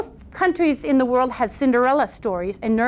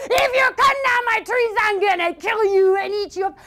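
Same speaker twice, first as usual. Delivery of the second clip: roaring troll; 10 semitones higher than usual. A narrator's reading. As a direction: roar it like an angry troll. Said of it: "troll screaming and roaring".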